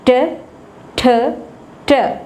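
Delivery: ट plain, ठ with a strong puff of air; isolated sounds. Shown as a demonstration. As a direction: say ट then aspirated ठ clearly apart. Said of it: "पहली आवाज़ को गौर से सुनना है". (ट और ठ का फ़र्क)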